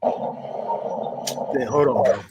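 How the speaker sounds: usual speaking voice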